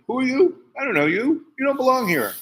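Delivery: high, loud, indignant demands; imitating baffled dad. Dad confronts the child he tripped over, loud and indignant.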